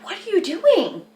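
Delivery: mock-outraged what are you doing; incredulous. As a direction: demand it in disbelief. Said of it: "And I was like".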